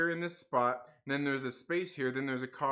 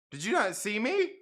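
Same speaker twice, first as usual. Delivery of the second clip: raised-pitch, affronted question; put-on accusing tone; mock indignant; 4 semitones higher than usual. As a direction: imitate an offended stranger demanding an answer. Acts out the indignant lady.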